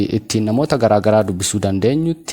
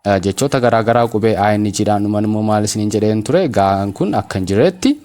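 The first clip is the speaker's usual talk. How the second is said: ordinary delivery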